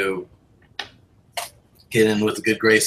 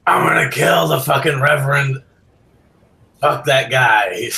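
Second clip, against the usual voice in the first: put-on loud, slurred drunken rant; drunken shouting; 6 semitones higher, 5 dB louder than usual. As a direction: bellow it like a blackout drunk. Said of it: "he starts shouting about how".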